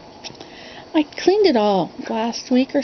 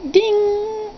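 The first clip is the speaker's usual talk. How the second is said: high, ringing "ding" held on one pitch; imitated piano note; 10 semitones higher than usual. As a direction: mimic a struck piano key being tuned.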